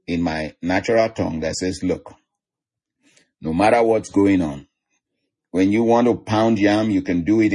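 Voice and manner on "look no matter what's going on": ordinary talk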